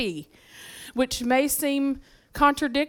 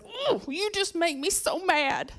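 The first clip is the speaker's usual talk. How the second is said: put-on higher, softer, slower whine of a mad teenage girl; whiny teen self; 6 semitones higher, 5 dB quieter than usual. Teen daughter to dad in the car, mock whine. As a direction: sulk it out like a ninth grader. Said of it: "And I said".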